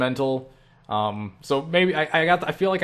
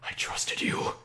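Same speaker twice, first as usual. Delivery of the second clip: hurt, breath-only whisper; soft acted heartbreak in a whisper; betrayed patient; 7 dB quieter, slower than usual. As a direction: whisper it as if wounded and watching from afar. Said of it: "looking through a window like".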